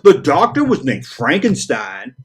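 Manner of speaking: drawn-out correction from a know-it-all commenter; mock commenter butting in to correct; nitpicking commenter. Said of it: "before you say".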